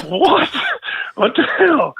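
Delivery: high-pitched retold bafflement; mock disbelief. Friend retelling his reaction to an airport prank, mock disbelief.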